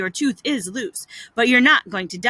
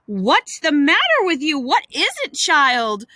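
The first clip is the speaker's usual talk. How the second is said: raised, insistent questioning; alarmed demand; 4 semitones higher, 3 dB louder than usual. Acted. Aunt questioning the groaning child, alarmed. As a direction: press for an answer, pitched up with worry.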